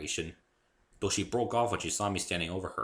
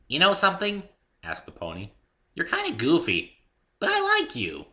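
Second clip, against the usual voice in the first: much higher, louder pony; much higher, louder fond pony; 10 semitones higher, 7 dB louder than usual. A narrator's reading. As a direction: squeal fondly like a pony. Pony affectionate.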